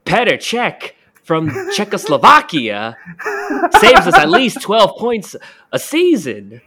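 slowly hammed-up footballer impression, cracking up as it goes; Christopher Walken-ish John Terry. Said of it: "He sounds like Christopher Walken now".

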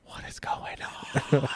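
slow hushed whisper. Retold or imitated. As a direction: breathe it like a worried crew member. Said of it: "they're like".

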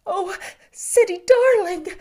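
high, loud, troubled cry; frightened mother. A narrator's reading. Mother holds her boy, high and frightened. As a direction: clutch him close in fear. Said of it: "in a frightened, troubled way".

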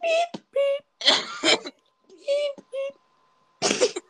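very high squeaked beeps; high "pee" beeps. Real, not acted. Winding up friends on a call, high beeps. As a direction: squeal out piercing beeps, one after another. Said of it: "longest pee sound I've ever heard".

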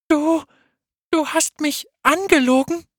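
very high, loud, stammered disbelief; shocked disbelief. Acted. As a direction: cry out feeling betrayed. Fayette discovers friend's betrayal.

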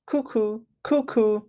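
high cuckoo clock call. Acted.

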